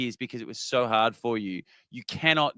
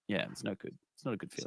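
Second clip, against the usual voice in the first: barely audible, trailing into breath; imitating a deflated Caro, voice fading away; dejected mumble; 2 semitones lower, 13 dB quieter, much faster than usual. Caro gets no votes, a deflated, fading mumble. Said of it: "She was just like".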